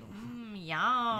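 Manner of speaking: toy's hummed yum; Furby imitation. Recalling what Furbies say, imitated toy voice. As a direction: hum into it like a Furby. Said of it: "what do they, like".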